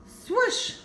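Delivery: plain reading voice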